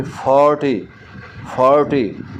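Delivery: Forty twice, four lengthened; stress on FOR. Shown as a demonstration. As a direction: lean on the first syllable four in forty. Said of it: "four जो है वो क्या है".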